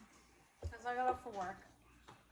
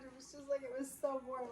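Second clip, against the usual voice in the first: ordinary talk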